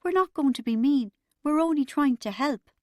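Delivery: high, pleading distress; near tears. A narrator's reading. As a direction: plead as if about to cry. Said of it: "Mum sounded like she was going to cry".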